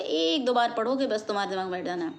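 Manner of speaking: usual speaking voice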